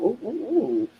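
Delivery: soft breathy oohs of guilt; quiet pained ooh ooh ooh; mock pained oohs. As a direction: wince it out as three soft oohs. Mock guilty wince after donating.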